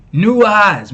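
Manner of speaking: loud, punchy call of new eyes; emphatic shout. Real, not acted. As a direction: hit new hard, falling. Echoing the clip, hyped.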